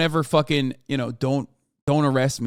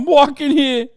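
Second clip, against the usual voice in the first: put-on New York accent; 11 semitones higher, 8 dB louder than usual. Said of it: "New York cop bit".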